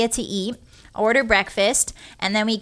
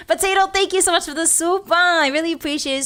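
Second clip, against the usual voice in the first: thanks in a raised, bright put-on voice; high chirpy thanks; 7 semitones higher than usual. Shown as a demonstration. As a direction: squeak out the thank-you. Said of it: "repeats the thanks in another voice".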